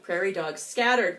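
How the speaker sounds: ordinary delivery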